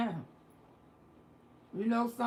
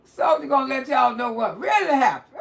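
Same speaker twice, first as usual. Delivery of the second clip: raised, loud hype-up; amused hollering; 4 semitones higher, 6 dB louder than usual. Real, not acted. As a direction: proclaim it loudly, about to crack up. Host hyping up the caller's story.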